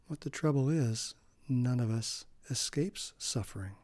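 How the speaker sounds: usual speaking voice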